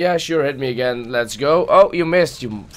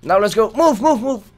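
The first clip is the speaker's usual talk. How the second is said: urgent urging; 8 semitones higher, 3 dB louder than usual. Real, not acted. Urging character on.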